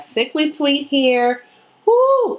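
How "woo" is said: high, delighted woo; excited squeal. Real, not acted. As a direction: squeal with joy at the colors.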